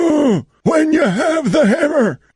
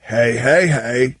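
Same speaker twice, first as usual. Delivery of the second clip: very low booming cartoon hey; Fat Albert voice; slower than usual. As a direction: boom the greeting deep and slow. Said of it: "[name]'s fake Fat Albert".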